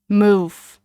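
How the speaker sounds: loud, tense one-word order; through clenched teeth. A narrator's reading. James blocked by the boys, ordering through clenched teeth. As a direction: push it out through gritted teeth. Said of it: "James said through clenched teeth".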